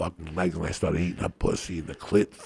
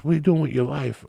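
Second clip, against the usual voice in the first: lightly voiced woman, higher pitch; imitated woman; 9 semitones higher, faster than usual. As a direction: lift your voice to mimic her question. Acts out the woman questioning him.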